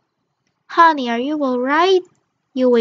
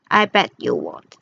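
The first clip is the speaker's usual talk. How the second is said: much lower, taunting bully voice; menacing bully; 11 semitones lower than usual. Acted. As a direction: dare them in a deep growl.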